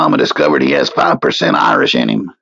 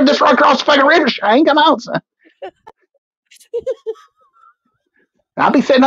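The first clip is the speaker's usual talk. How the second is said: much higher-pitched comic Irish voice; Irish accent; 10 semitones higher than usual. Acted. Calling Shane across the river to share gold, mock Irish accent. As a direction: put on a high, hammed-up Irish accent. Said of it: "You did an Irish accent".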